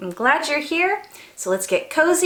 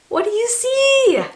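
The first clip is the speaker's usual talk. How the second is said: excited gasp; 10 semitones higher, 4 dB louder than usual. Pretend. Storyteller to children, gasping with high excited wonder. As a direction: gasp then a high, louder wondering question. Pretends to peek out window.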